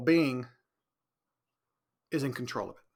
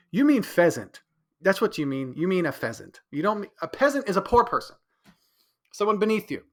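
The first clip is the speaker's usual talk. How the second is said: louder, emphatic correction; correcting; 6 semitones higher, 6 dB louder than usual. Retold or imitated. Correcting his girlfriend's word choice, loud and emphatic. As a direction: set her straight, amused.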